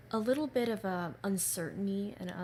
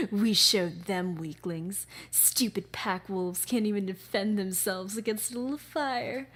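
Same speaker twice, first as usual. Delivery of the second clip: sinister antagonist; 6 dB louder than usual. Acted. Villain mocking pack wolves, loud sinister sneer. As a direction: sneer at the weaklings, savouring each word. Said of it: "deep female vocals and a lot of sinister tones".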